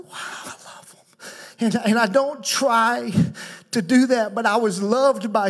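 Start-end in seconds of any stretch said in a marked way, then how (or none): 0.0-1.3 hushed, breath-only wonder; awed whisper. Acted. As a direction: whisper it as the heart's amazement. Voicing his heart's awe at people.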